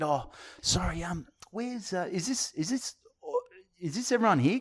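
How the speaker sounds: hesitant self-quote. Retold or imitated. Comedian asking the organiser, high and unsure. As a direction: fumble the question, voice up high. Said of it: "And I said".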